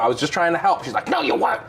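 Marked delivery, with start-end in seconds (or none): 1.1-1.6 high mock retort; imitated freak-out. Passenger rejecting driver's help, imitated high freak-out. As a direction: throw it back in a high outraged voice. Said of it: "freaking out on me".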